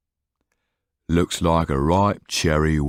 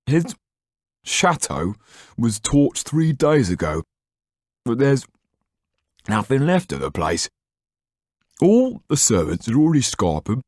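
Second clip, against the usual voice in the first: halting, higher talk round a mouthful; speaking with mouth full; 7 semitones higher than usual. Acted. Didier reporting on the Marquis, mouth full of bread. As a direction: talk through a bite of bread. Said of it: "speak with his mouth full".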